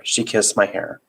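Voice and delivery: plain reading voice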